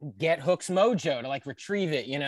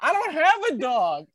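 laughing; 8 semitones higher, 4 dB louder than usual. Retold or imitated. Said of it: "Hook goes".

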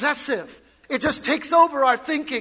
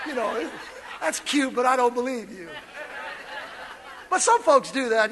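ordinary talk

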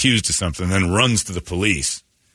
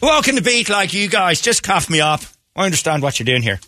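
put-on Irish accent; 11 semitones higher than usual. Flattering the arresting police, hammed-up Irish accent. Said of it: "go into your Irish accent".

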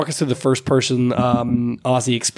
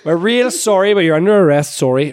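put-on muddled Canadian accent drifting into Irish; mixed Canadian-Irish accent; 2 semitones higher, 3 dB louder than usual. Polite Canadian police officer arresting a driver, muddled Canadian-Irish accent. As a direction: do an apologetic Canadian cop, accent sliding Irish. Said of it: "weird mix of Canadian, some form of Irish".

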